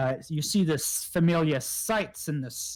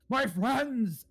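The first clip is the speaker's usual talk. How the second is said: high, delighted character call; excited greeting; 10 semitones higher than usual. Acted. Luresh greets his hobgoblin friends, excited greeting. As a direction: call out high to old comrades.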